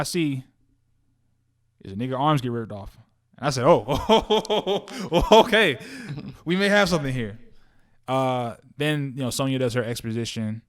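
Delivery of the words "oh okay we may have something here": raised, louder, laughing realization; impressed reaction with a laugh, pitched up; pleasantly surprised. Retold or imitated. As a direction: replay a delighted reaction, chuckling into it. Acts out pleased reaction to trailer.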